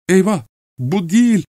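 high-pitched cry of dismay; horrified cry. A narrator's reading. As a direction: cry out in shock at the wrong man. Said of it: "diye haykırdı". (dehşetle, tiz sesle haykırarak)

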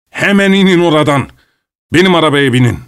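high-pitched, insistent command; shouted order. A narrator's reading. Vilchanov orders Pavel out of the carriage, shouting. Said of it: "diye bağırdı Vilchanov".

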